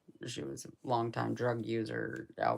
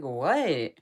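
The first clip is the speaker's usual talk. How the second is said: loud what; 2 semitones higher, 10 dB louder than usual. Retold or imitated. Her reaction to mom's call.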